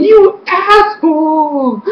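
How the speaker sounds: high, slow, indignant outburst; mock-furious fan yelling at the villain; outraged. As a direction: stretch the insult out in disbelief, high. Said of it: "I was like".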